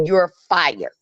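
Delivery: normal talking voice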